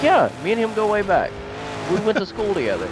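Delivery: casually name-dropping; boastful claim. Retold or imitated.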